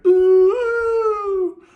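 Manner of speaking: high, loud mock monster howl; imitated howl. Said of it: "that final howl at the end".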